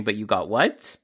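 ordinary delivery

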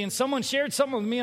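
ordinary talk, nothing marked

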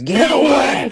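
put-on high shout of a possessed boy; screaming. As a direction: shriek it to drive someone off. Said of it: "he starts screaming".

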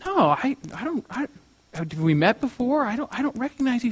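soft, high, halting confusion; acted bewilderment, hesitant and hushed; baffled denial. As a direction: stammer it softly, searching for the memory. Painter baffled by the question.

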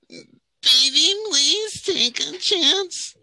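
very high, drawn-out helium whine; terrible singer parody. Retold or imitated. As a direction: whine it absurdly high, mocking her. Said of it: "Did you just inhale helium?".

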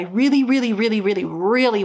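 stack the "really"s with weight on every one; insistent repeated "really"; emphasis on really. Shown as a demonstration. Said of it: "emphasis on really".

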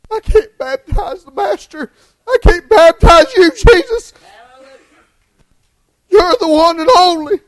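high, loud, pleading cry. Acted. John crying out to Jesus at the river, high and loud. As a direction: cry it out, refusing in awe.

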